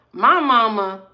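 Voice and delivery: normal talking voice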